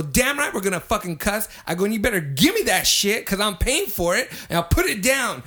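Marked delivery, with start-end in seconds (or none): 0.0-1.5 high-pitched, combative re-enactment; retold defiant anger. Retold shouting match at bar. 1.8-5.4 high, loud, combative re-enactment; retold angry demand. Retold demand for held drinks.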